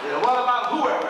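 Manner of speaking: fast, loud, high follow-up; eager student challenge. Retold or imitated. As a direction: fire the question straight back. Voices student's quick follow-up challenge.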